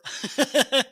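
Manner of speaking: bright giggle; high burst of laughter. Real, not acted. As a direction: laugh out loud. Laughs at own remark.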